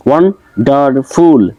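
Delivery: ওয়ান্ডারফুল split as ওয়ান, ডার, ফুল; three separate beats on ওয়ান্ডারফুল; syllable by syllable. Shown as a demonstration. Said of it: "তিনটা দেওয়া আছে".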